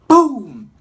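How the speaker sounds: sudden loud, high boom. Retold or imitated. Telling how he hit his head, a loud boom. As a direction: blurt the crash out.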